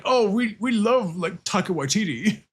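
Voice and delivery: lightly mock the fickle Americans. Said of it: "And nowadays they're like".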